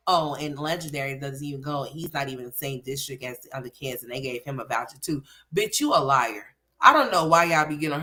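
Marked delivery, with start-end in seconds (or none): 0.1-5.2 mocking imitation. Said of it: "And then you're gonna say".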